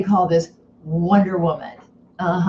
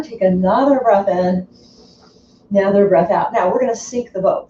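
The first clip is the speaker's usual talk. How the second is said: ordinary talk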